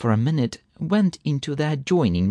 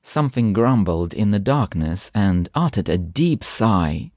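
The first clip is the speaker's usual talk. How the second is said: ordinary delivery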